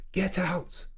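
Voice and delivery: high barked "Get out."; Gordon Ramsay impression. Streamer ordering a cook out, high put-on bark. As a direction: throw the cook out of the kitchen. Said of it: "This is my Gordon Ramsay impression".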